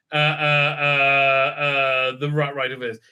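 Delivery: ordinary talk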